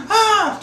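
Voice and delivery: very high, loud imitated girls' shriek; scared scream. Girls hear banging on the windows, imitated scared shriek. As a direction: shriek in mock terror. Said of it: "Totally scared".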